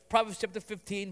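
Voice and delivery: usual speaking voice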